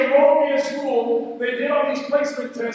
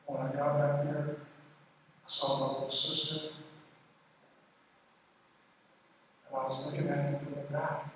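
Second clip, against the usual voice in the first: much softer, lower, intimate retelling; hushed storytelling; 10 semitones lower, 12 dB quieter than usual. Real, not acted. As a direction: drop the voice to a near hush for the painful memory. Long hushed painful childhood story.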